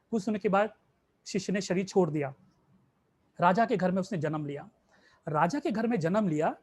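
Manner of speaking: ordinary talk, nothing marked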